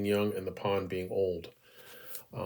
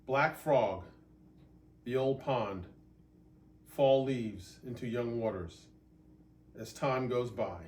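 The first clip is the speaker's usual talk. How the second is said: poem reading; 2 semitones higher, 3 dB quieter than usual. Acted. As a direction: read each line softly, with long pauses. Reads his haiku aloud.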